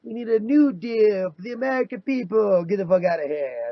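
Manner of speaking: mock Trump speech; Trump impression. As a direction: boom it like Trump at a rally. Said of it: "I said in my R N C speech".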